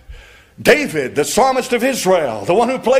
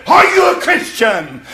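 a much higher, louder accusing yell; mob scream; 7 semitones higher, 3 dB louder than usual. Retold or imitated. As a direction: scream the question in her face like the mob. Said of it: "they said it the way I just said it".

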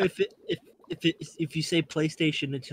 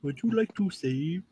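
imitating the game voice; 3 semitones higher than usual. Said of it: "game voice asks same line".

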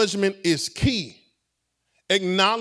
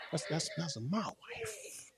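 hushed awe; 3 semitones lower, 19 dB quieter, slower than usual. Real, not acted. Overcome saying that's my wife.

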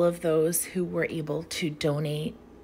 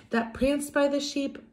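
usual speaking voice

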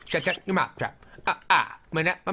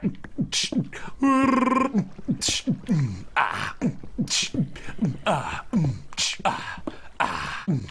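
tired beatboxing; 2 semitones higher, slower than usual. Real, not acted. Worn-out beatboxer pushing through the beat. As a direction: keep beatboxing though tired.

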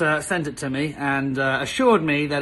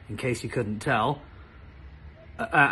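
normal talking voice

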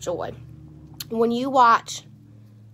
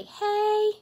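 cheery hey; 11 semitones higher than usual. Shown as a demonstration. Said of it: "If y'all pop up here, say".

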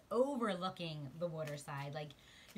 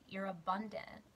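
ordinary talk, nothing marked

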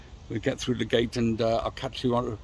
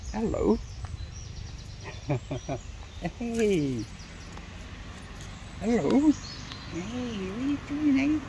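high, sing-song pet voice, drawn out; talking to a dog; 8 semitones higher, 5 dB quieter than usual. Real, not acted. Greeting a dog on the path.

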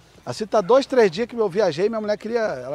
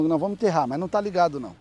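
ordinary talk

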